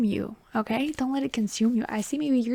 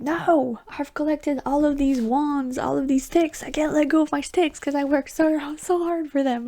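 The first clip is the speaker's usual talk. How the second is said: clingy mock protest; 5 semitones higher, 3 dB louder than usual. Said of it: "'cause you're like".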